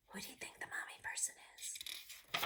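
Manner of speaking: unvoiced whispered question. Real, not acted. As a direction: whisper the mystery.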